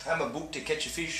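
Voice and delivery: badly faked Canadian accent, quiet; terrible mock Canadian accent. Joking player after talk of Canadians, bad Canadian accent. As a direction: try a bad Canadian accent under your breath. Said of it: "I don't even know what accent that was".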